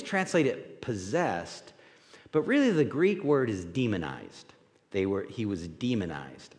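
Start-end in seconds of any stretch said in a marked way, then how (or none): none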